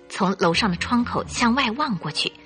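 usual speaking voice

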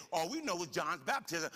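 fired-up pulpit preaching; full-force preaching. Acted. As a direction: preach it out to the congregation.

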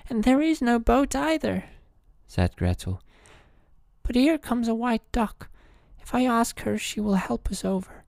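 put-on high, sorrowful young girl; little girl voice. A narrator's reading. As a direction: lament like a frightened child. Gretel voiced in the story.

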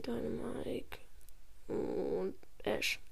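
hushed whisper while revealing names. Real, not acted.

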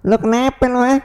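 high, fast, picking a fight; ugly thug voice. Pretend. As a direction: taunt them like a street punk. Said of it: "Lu suara jelek banget lo". (suara preman jelek yang menantang)

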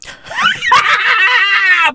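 high shouted burst of laughter. Real, not acted. Comedian cracking up at his punchline. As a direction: cackle at your own joke.

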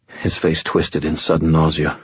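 usual speaking voice

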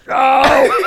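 high, shouted wordless "Oh!"; loud imitated yell. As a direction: blurt one loud high noise, mimicking someone unintelligible. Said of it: "the only thing that you heard was".

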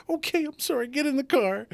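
very high, flustered wife voice; high-pitched frantic imitation; panicked apologetic wife. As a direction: apologise and hurry them in a mock panic.

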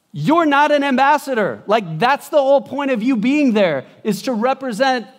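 loud, high-pitched indignant scolding; acted rebuke. Someone back home calls the ambassador to account, scolding loudly.

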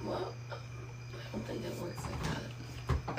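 slow unvoiced whisper. Real, not acted. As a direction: whisper it doubtfully. Doubtful aside.

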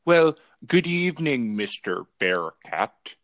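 slow, drawn-out villain greeting in a mock British accent; Dr. Evil. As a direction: purr it out like a smug movie villain. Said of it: "Doctor Evil".

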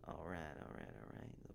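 worst-ever McConaughey, mumbled; Matthew McConaughey impression. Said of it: "The worst fucking Matthew McConaughey impression".

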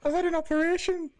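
very high comic character voice; silly high-pitched announcement; put-on squeaky Geordie voice. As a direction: squeak it in mock Geordie falsetto. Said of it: "when you do that voice".

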